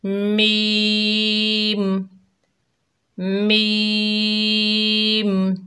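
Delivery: मीम held very long, even and steady; मीम sung long, five alif length; drawn-out letter name. Shown as a demonstration. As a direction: stretch मीम out slowly on one pitch. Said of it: "पढ़िए।".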